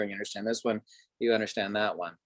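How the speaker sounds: normal talking voice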